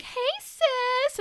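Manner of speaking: very high, sugary greeting; fake sweet sisters. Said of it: "So they're like".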